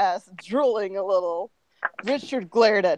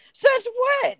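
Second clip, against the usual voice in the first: very high, squawked disbelief; high-pitched incredulous question; startled question. Acted. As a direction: shoot back in shrieky surprise. Character questions a surprise claim.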